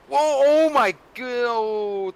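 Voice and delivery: loud, high, drawn-out gasp of disbelief; real shock at a big wreck; shocked reaction. Real, not acted. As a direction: stretch it out, high and stunned. Co-commentator reacts to the crash.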